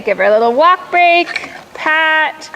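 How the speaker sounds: usual speaking voice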